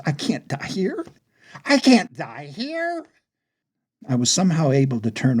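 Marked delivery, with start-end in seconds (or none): 0.0-1.1 high-pitched dread, rising at the end; panicked thought. Acted. 1.6-3.1 slow, high, drawn-out cry of terror; mounting panic. Acted. Panicked thought repeated, more intense.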